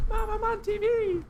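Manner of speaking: very high squeaky, thin and strained; squeaky kid voice. Pretend. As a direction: squeak it like a tiny cartoon kid.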